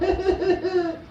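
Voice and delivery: high, jeering laugh of bullies; a short mock sneering laugh. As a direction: cackle at the girl. Mocking classmates laugh.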